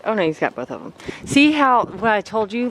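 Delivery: ordinary talk, nothing marked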